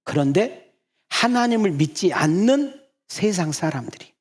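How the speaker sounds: slow, high and loud building sermon voice; preaching. Real, not acted. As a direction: build toward the point, drawing it out. Sermon build-up to key point.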